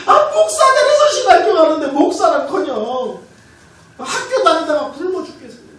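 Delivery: hammed-up tearful protest, high and loud; 울며 외치는 기도. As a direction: wail a complaint to God in mock desperation. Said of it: "울면서 기도했어요". (높고 크게 울부짖으며 하소연하는 흉내)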